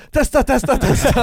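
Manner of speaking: high chant of testa; eager lad. Retold or imitated. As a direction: urge him on like a hyped friend. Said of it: "drar fram sin dos och bara".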